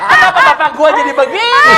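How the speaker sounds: playful fake lament, laughing; mock wailing father. Voicing crying father in game.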